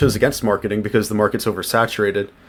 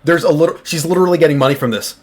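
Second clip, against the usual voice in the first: rapid, loud, higher-pitched rant; talking fast; 4 semitones higher, 6 dB louder, faster than usual. Real, not acted. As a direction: race through it in outraged disbelief. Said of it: "Wow, I'm talking fast".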